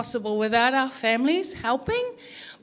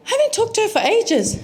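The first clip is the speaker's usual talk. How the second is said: lamenting son; 10 semitones higher, 4 dB louder, faster than usual. Retold or imitated. Son's lament, voiced by mother.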